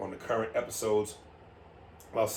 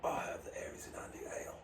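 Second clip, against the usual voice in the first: low husky movie-star whisper; 13 dB quieter than usual. Pretend. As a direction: whisper it like an action hero. Statham line, whispered.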